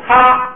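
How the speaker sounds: 啪 on a high level tone, aspirated; 高平调. Shown as a demonstration. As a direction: hold 啪 high and even. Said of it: "既变高调". (高平调送气地念“啪”)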